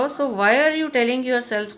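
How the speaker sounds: exasperated confusion; bewildered. Retold or imitated.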